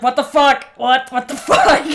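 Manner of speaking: loud, baffled what the fuck; retold disbelief. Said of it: "I was like".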